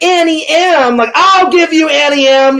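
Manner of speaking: high, shouted warlock taunt; wicked warlock impression. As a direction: taunt her like a gloating warlock. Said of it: "the wicked warlock's like".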